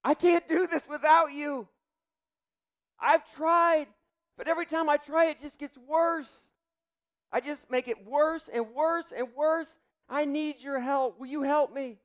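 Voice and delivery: high, loud, slow pleading cry; desperate plea to God. Shown as a demonstration. Believer begging God for help, high and desperate. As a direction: cry out to God like a helpless child. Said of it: "the next thing that you need to do is say".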